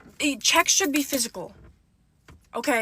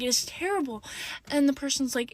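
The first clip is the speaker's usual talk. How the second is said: ordinary delivery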